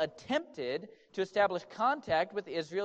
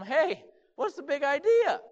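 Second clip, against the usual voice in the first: high, loud voice of an affronted donkey; indignant donkey; 8 semitones higher, 5 dB louder than usual. Acted. Smacked donkey talks back to Balaam, indignant. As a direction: protest in a put-on, raised voice.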